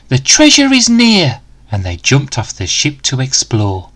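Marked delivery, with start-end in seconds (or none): 0.1-1.4 high, eager announcement; thrilled the gold is close; cheering. Acted. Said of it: "Danny cheered".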